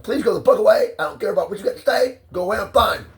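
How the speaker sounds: high, loud, furious orders; angry yelling. Acted. Telling her to get out of his room, a loud high yell.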